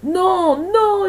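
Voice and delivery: high, loud desperate howl; frantic full-voiced cry; screaming. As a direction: howl your refusal. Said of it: "hurlait-elle".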